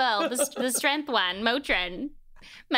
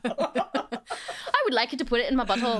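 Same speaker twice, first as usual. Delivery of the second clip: higher, laughing Irish lilt; mock Irish accent; 4 semitones higher than usual. Continuing the bawdy accent joke, laughing mock Irish. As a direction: ham up Irish through giggles. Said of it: "wanna have an Irish accent".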